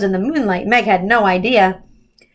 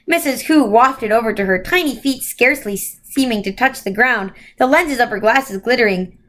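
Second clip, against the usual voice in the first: ordinary delivery, nothing marked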